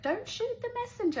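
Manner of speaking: very high put-on voice; squeaky caller impression; Louis. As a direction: imitate him in a high-pitched mock plea. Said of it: "Louis calls me".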